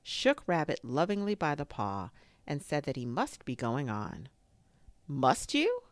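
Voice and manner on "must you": high, lightly courteous question; polite. A narrator's reading. Rabbit to a departing guest, polite. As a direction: ask politely with a raised pitch. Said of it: "said Rabbit politely".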